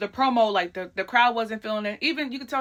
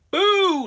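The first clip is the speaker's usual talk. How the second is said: higher, louder drawn-out boo; crowd boo; 11 semitones higher, 7 dB louder than usual. Retold or imitated. Crowd booing Charlotte, imitated loud boo. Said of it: "the crowd is booing Charlotte".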